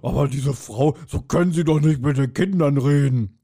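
lightly voiced kindly elephant; a hint of a cartoon elephant scolding gently; Benjamin Blümchen. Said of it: "würde Benjamin sagen". (ein Hauch von Benjamin Blümchen, sanft tadelnd)

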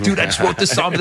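mild mock excitement from a proud bandmate; imitating excited Joey. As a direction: channel his eager bragging, lightly. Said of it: "He's excited".

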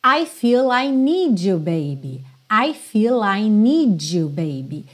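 "need you" blended into a J sound; D-J link. Shown as a demonstration. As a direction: let the D of "need" merge into "you". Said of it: "junção do D do need com you". ("need you" soando como nidju)

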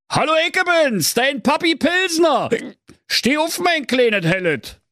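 high-pitched Berlin papa king; hearty wake-up call. A narrator's reading. King loudly waking his daughter.

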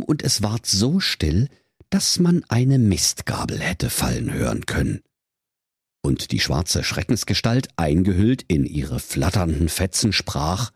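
plain reading voice

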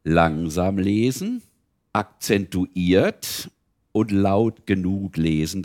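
very slow, each word set apart; slow accented reading. Shown as a demonstration. Reader showing the pupils how to read, very slow and deliberate. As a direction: spell out the reading rules slowly, word by word. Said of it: "was wichtig ist beim Lesen".

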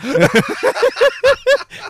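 high loud laughing fit; real outburst of laughter; burst of laughter. Real, not acted. (lautes Lachen)

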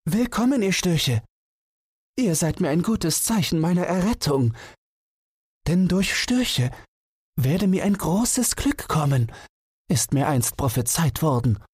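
very high, joyful owl voice. A narrator's reading. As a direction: call out like a delighted owl. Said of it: "rief sie".

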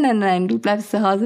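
usual speaking voice

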